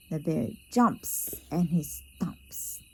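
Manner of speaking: ordinary delivery, nothing marked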